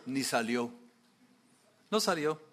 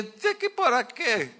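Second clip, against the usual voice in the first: much higher, slow fed-up question; exasperated; 9 semitones higher, slower than usual. Retold or imitated. Naaman in the river after seven dips, exasperated. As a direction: throw up your hands at the pointless washing.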